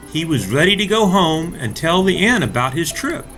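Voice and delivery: ordinary delivery, nothing marked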